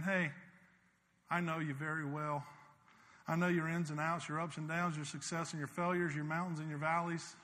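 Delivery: soft, hushed voice of Jesus; tender invitation. Acted. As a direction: draw them in gently, almost a murmur.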